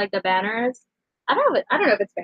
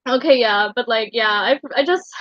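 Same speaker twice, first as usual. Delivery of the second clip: ordinary talk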